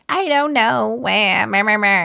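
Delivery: high, wailing fake sobs; mock crying. Host mocking the mother crying to producers, mock wail. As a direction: blubber it high in mockery. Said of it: "she immediately starts crying and is like".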